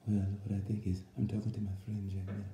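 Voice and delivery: low, soft mumble of a commentator; Simon impression. Commentator Simon answering from pit lane, in a low soft mumble. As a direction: mumble it low and quiet like a muffled broadcast voice. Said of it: "I had to get that impression in".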